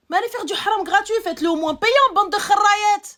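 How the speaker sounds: loud, high outburst; angry berating. Real, not acted. Berating women for sinning for free, angry. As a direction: blast them at full volume, exasperated.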